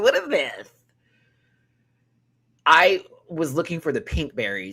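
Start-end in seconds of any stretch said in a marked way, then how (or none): 0.0-0.7 what is this. Retold or imitated. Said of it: "I was like".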